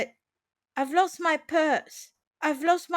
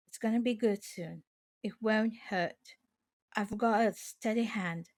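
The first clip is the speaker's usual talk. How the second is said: soft, hushed and soothing, much quieter; comforting; 6 semitones lower, 9 dB quieter than usual. Shown as a demonstration. Soothing a panicked person, soft and hushed. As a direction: gently talk a frightened person down. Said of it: "Comforting someone".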